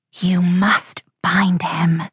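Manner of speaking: slow, whispered command; eerie disembodied voice. A narrator's reading. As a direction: whisper it drawn out, like a voice out of fog. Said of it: "said the voice again".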